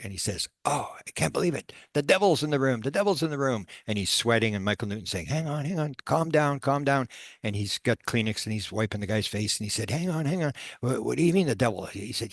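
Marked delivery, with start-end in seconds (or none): 0.6-3.7 high-pitched panicked cry, acted out; freaking out. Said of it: "the guy starts freaking out". 5.3-7.1 high-pitched, pressing attempt to settle someone; urgent calming. Hypnotist calming a panicking client. 9.9-11.9 urgent questioning. Retold or imitated. Hypnotist alarmed by client's claim.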